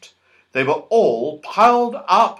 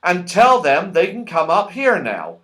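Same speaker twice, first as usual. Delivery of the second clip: higher, louder cheerful host; Moonface voice; 4 semitones higher, 4 dB louder than usual. A narrator's reading. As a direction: hand out orders brightly. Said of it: "Moonface giving orders".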